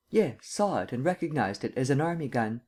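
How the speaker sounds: ordinary delivery, nothing marked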